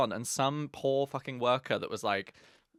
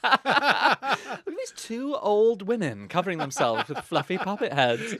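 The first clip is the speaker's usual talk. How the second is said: slow, louder, higher put-on voice, laughing at the end; mock scandalised stranger; 6 semitones higher, 5 dB louder than usual. Co-host joins in as the shocked worker, slow and loud. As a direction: drag it out as a gawping stranger.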